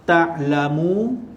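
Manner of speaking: "Ta'lamu" recited slowly with a rising tune; slow recitation. Shown as a demonstration. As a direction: draw out "Ta'lamu" as in Quran reading. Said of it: "Okey, ta'lamu".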